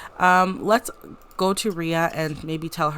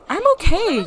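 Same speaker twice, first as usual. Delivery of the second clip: character voice; 14 semitones higher, 9 dB louder than usual. Acted. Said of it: "She's like".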